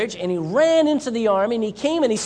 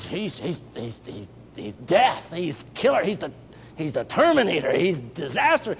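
fearful stammer; 6 semitones lower than usual. Acted. Terrified soldiers stammer about Goliath.

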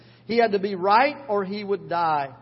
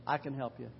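much lower and softer, tender; God answering a broken sinner, low and soft; God's gentle reply; 8 semitones lower, 11 dB quieter, faster than usual. Acted. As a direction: hush it down like a father comforting. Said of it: "God says".

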